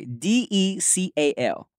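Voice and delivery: slow letter-by-letter spelling; measured, separate letters. Shown as a demonstration. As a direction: spell it out slowly, one letter at a time. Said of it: "Just spell it".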